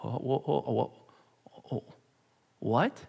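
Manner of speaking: soft, breathless stammering; stunned stammer. Acted. Saul stunned on the ground, soft stammering what. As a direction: stagger over the word, dazed.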